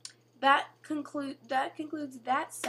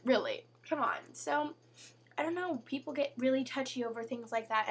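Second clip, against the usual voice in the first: ordinary talk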